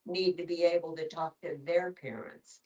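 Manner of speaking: ordinary delivery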